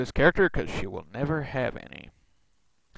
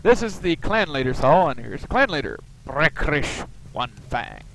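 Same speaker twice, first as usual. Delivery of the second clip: ordinary delivery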